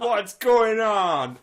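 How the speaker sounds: high, loud, very slow mocking caller voice; hammed-up imitation of a radio caller, very slow; caller's put-on voice. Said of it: "this is the voice that she did on the phone".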